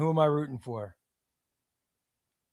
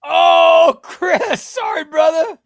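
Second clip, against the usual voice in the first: much higher, louder shout; loud callout; 10 semitones higher, 10 dB louder, much slower than usual. Real, not acted. Streamer eliminating a viewer, loud high shout then apology.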